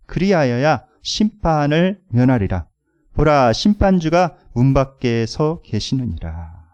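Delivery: loud, slow, measured reading of scripture; scripture reading aloud. Acted. Preacher leading the congregation in reading, loud and measured. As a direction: lead the room through the verse, loud and slow.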